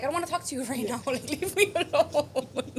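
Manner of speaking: annoyed brush-off. Retold or imitated. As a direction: push your man away, drawn out and irritated. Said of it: "and I'm like".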